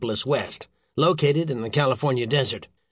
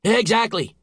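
high, emphatic exclamation; agitated boss, pitched up; worked-up cry; 9 semitones higher, 4 dB louder than usual. A narrator's reading. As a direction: pounce on the answer angrily. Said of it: "cried the Secretary".

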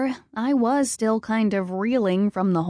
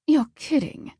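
higher, scoffing reaction; snorted disbelief; 6 semitones higher than usual. A narrator's reading. Loki scoffs at Ares's claim, snorting disbelief. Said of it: "Loki snorted".

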